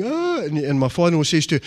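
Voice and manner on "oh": high mock wail of a crying mother; imitating a sobbing mother-in-law, pitched up. Mother-in-law wailing as her daughter marries, mock sob. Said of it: "my mother-in-law was saying".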